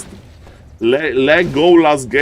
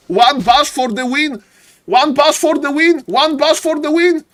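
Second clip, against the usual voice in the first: excited play-by-play; 13 semitones higher, 3 dB louder than usual. Real, not acted. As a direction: build to the winning blow and hold the final call. Live esports play-by-play.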